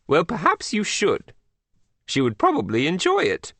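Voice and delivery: high, swooping British royal voice; hammed-up British monarch; Queen of England. A narrator's reading. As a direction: advise him regally in a British accent. Queen advises boy in British voice.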